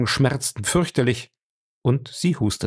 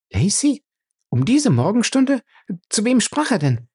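alarmed disbelief; 12 semitones higher, 3 dB louder than usual. Acted. Fay alarmed at the news.

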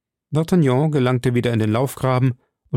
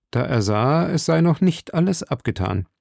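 plain reading voice

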